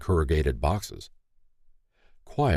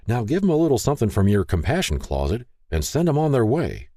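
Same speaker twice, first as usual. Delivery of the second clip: higher, firm but mild urging; gentle chiding; 7 semitones higher than usual. A narrator's reading. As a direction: urge your wife mildly, pitched up. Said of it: "Paul gently chided".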